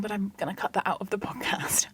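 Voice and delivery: whispered aside to listeners; soft unvoiced whisper. Real, not acted. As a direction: hush it as a secret. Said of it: "whispered aside".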